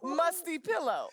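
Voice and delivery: giggling through a mocking nickname; laughing taunt. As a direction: snicker it out as a put-down.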